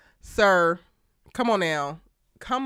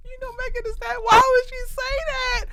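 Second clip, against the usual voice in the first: high, breaking words through laughter; can't stop laughing; high laughing cry; 4 dB louder than usual. Real, not acted. Asking viewers why she said it, laughing high.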